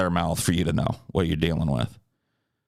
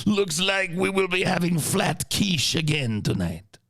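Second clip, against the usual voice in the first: higher, slow French chef voice; 8 semitones higher than usual. Retold or imitated. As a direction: put on a French chef accent, laughing. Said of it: "it went".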